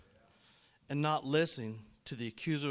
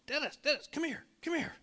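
high-pitched imitated call, not loud; calling someone over; 5 dB louder than usual. Workman calling the speaker over on site, high calling-out. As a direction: mimic an urgent summons, pitched way up. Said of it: "he's calling me".

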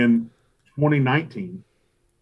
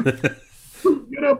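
urgent wake-up call; 14 semitones higher than usual. Retold or imitated. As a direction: laugh while shouting him awake. Waking his teammate in a rush.

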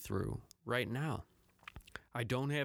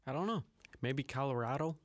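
normal talking voice

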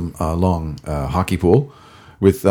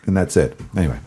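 ordinary delivery

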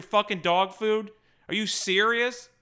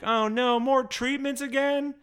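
higher-pitched imitation of a dying girl; lightly put-on child's voice, hammed-up dismay; mock somber child; 8 semitones higher than usual. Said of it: "says in this somber tone".

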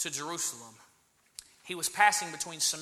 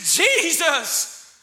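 loud cry; 7 semitones higher, 6 dB louder than usual. Acted. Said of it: "and were loud".

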